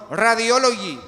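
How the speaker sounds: imitating Radiology said Spanish-style as "ra-dio-LO-gee"; mock the staff's Spanish Radiology; imitating a Spanish accent on Radiology; mispronounced radiology. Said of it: "Es lo que me dicen".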